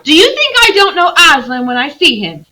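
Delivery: high, loud challenge; indignant girl. Acted. Lucy retorting to Trumpkin, high and indignant. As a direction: throw the question back at him. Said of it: "said Lucy".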